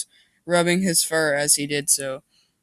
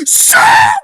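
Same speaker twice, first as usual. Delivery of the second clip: loud breathy shout of a name; calling out; 15 dB louder than usual. Acted. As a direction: call the dog from across the house. Calling out to the dog.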